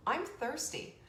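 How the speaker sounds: usual speaking voice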